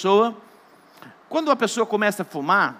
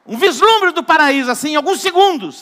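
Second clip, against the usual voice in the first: higher and louder, rising wonder; excited; 7 semitones higher, 6 dB louder than usual. Real, not acted. Preacher to congregation, awed and excited. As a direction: lift it like a sudden glimpse of paradise.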